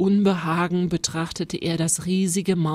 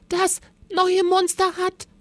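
very high, cut-off cry; urgent shout; 13 semitones higher, 3 dB louder than usual. A narrator's reading. Rosa warning Paul about the monster, shouting high. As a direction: call out urgently until cut off. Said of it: "schrie sie so laut".

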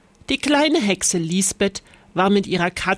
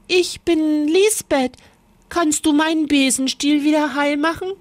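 high, eager little witch; bright asking girl, quick; Lisbeth; 7 semitones higher than usual. A narrator's reading. Lisbeth asks the witch to fix her broom, high and quick.

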